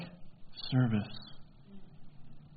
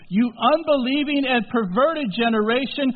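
louder, higher exasperated rebuke; 6 semitones higher, 6 dB louder than usual. Acted. Jesus scolding his disciples, exasperated and loud. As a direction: scold them as a weary teacher.

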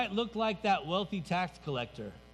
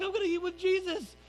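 much higher, giddy burst of joy; overjoyed tax collector; 12 semitones higher than usual. Acted. Zacchaeus thrilled that Jesus will eat with him, high and giddy. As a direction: squeal it like a thrilled child. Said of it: "And he gets so happy".

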